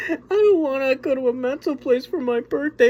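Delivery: high, loud whiny wail; mock crying girl. Younger sister crying to mom about her birthday, high mock wail. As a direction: wail it like a sobbing kid. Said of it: "and she starts crying, and she's like".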